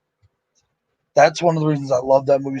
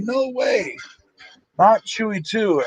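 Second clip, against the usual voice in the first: imitating his heartbroken disbelief, pitched higher; retold dismay; 6 semitones higher, slower than usual. Fan retelling his reaction to Chewie's death, high and slow. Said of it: "my heart sank".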